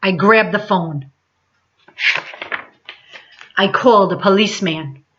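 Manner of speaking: ordinary delivery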